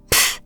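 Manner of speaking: short puff of air through the lips; dismissive scoff. Acted. Captive witch waits on her captor, scoffing. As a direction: blow out a scornful pfft.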